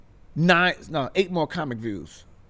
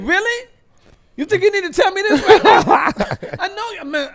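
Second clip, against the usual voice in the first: much higher and louder, laughing in shock; stunned disbelief; 7 dB louder than usual. Retold or imitated. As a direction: react like a star just walked in. Starstruck at Spike Lee entering.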